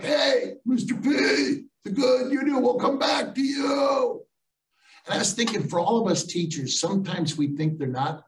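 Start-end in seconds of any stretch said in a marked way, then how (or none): 0.0-4.2 high, drawn-out holler from a passing truck; imitated far-off yell, pitched way up. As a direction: call it out high, stretching the words. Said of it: "he yells off the truck".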